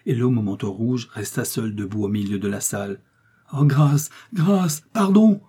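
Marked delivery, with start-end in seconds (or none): 3.5-5.4 high, slow, pleading cry; begging for mercy. Acted. Milady falls to her knees, begging the executioner for mercy. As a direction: drag out the plea on your knees. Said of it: "cria la misérable en tombant à genoux".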